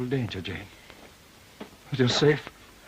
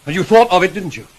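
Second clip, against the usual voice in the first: sharp accusation; 5 semitones higher, 10 dB louder than usual. Acted. Accusing her of planning to leave.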